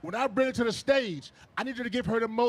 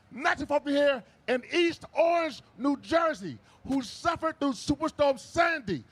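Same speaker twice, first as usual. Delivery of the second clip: usual speaking voice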